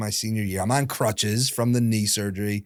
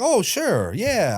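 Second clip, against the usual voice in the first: high-pitched mock agreement; sarcastic; 8 semitones higher, much slower than usual. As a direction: humour Dad with a sarcastic, lifted yes. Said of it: "I was being sarcastic back".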